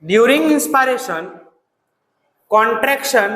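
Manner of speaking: slow, spaced dictation for notes. Shown as a demonstration. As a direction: dictate slowly so students can write. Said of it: "dictation pace". (धीमे-धीमे लिखवाते हुए)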